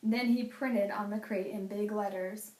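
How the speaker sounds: ordinary delivery, nothing marked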